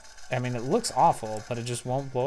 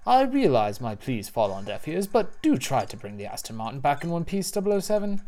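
reading Q's line; 3 semitones higher, 3 dB louder than usual. Retold or imitated. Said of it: "Q says".

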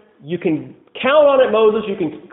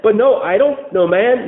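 ordinary talk

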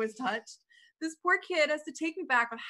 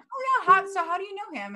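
higher, louder bright party question; curious partygoer; 8 semitones higher, 5 dB louder than usual. Retold or imitated. Guests at the Christmas party asking, higher and brighter. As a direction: chirp it like a nosy guest. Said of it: "I had people be like".

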